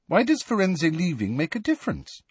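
high, inquiring voice; curiously. A narrator's reading. Hermione asks Hagrid about Firenze, curiously. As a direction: wonder it aloud, wanting the reason. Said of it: "asked Hermione curiously".

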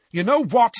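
high, loud heated challenge. A narrator's reading. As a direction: burst out hotly. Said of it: "said Seamus heatedly".

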